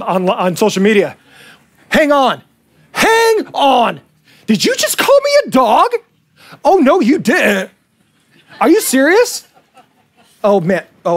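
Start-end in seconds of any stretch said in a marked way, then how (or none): 1.9-9.5 mock outrage. Imagining someone blowing Jesus up on social media, mock outrage. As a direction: play at being scandalized, pitch leaping up on the insult. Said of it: "Imagine how you'd blow Jesus up".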